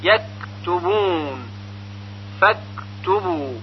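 یکتبون with an aspirated, unbounced ک; slow and soft, breathy release on ک; kaf without qalqalah. Shown as a demonstration. Teacher showing learners a still kaf, no echo in فاکتبو.

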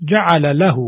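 جعل له with the fatha on له kept short; short vowel demo. Shown as a demonstration. Teacher repeating the short fatha example. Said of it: "صدای کوتاه فتحه رو داره".

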